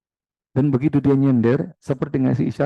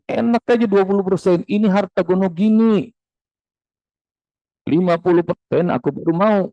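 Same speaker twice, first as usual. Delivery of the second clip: raised, indignant retort; mocking ex-husband; 6 semitones higher than usual. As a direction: snap back like a greedy ex-husband. Ex-husband retorts over house share.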